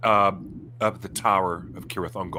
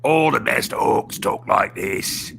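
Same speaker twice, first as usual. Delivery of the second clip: slow thick Cockney orc voice; Cockney accent; 5 dB louder, slower than usual. Shown as a demonstration. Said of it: "speak with the most thick Cockney accent".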